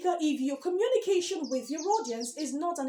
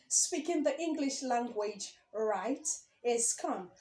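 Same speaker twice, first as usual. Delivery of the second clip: slower, quieter, soft and calm; 3 semitones lower, 5 dB quieter than usual. Shown as a demonstration. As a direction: say it calmly, as if showing the calm. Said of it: "speaking right is calm".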